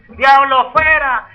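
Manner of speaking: high, loud, drawn-out mock exorcist shout; hammed-up loud exorcist cry. Shown as a demonstration. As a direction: yell it slowly like a showy exorcist. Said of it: "yo gritar y vociferar aquí".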